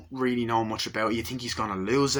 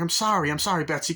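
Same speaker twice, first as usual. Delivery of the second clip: higher, louder frantic apology; pleading apology; 8 semitones higher, 5 dB louder, faster than usual. Retold or imitated. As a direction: beg her to stay, retold. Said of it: "He's like".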